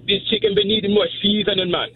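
high-pitched Jamaican cooking-show voice; Jamaican accent. Shown as a demonstration. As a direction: put on a Jamaican accent like a TV cook. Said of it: "like, a Jamaican accent".

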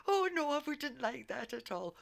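imitating the caller. Retelling the gorilla-loving caller's reply, lightly mimicked. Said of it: "And she went".

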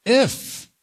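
IF alone, pitched high; a short, high, isolated "if"; stress on IF. Shown as a demonstration. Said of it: "if you put emphasis on the word".

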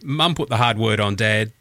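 ordinary talk

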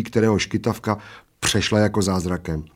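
plain reading voice

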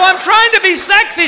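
high-pitched shouted excuse; defensive protest. Retold or imitated. Husband in a bow defending himself to wife, defensive. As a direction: protest back in comic desperation.

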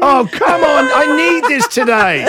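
high, loud mock begging; pleading whine. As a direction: whine for a compliment. Begging for a compliment.

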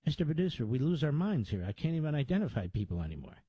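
normal talking voice

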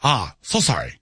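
hammed-up Japanese-accented apology; mock Japanese accent. Host joking after his karaoke excuse, mock Japanese accent.

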